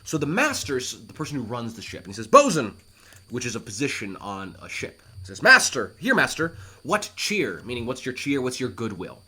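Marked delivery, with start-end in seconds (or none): 2.3-2.8 calling out. Acted. Master calls to bosun. 5.4-7.6 calling out. Acted. Bosun answers the master.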